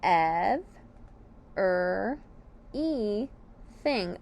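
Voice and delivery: "ev, er, e, thing" with a pause after each; syllable by syllable. Shown as a demonstration. Teacher lists the syllables of everything, broken apart. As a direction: split "ev, er, e, thing" into four separate beats. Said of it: "The syllables are".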